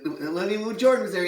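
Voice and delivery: usual speaking voice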